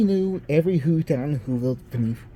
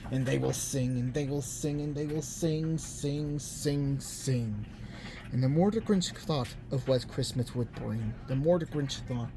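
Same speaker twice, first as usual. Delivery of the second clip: much softer, fading hush; 7 dB quieter than usual. A narrator's reading. Grinch brooding over the Whos' singing, fading to a hush. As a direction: let it sink to a near whisper.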